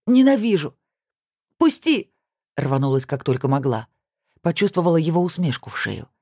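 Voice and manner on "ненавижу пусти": high cry of hatred; desperate fury. A narrator's reading. Woman tearing free of her groping ex, furious high cry. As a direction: cry it out, pitch jumping up.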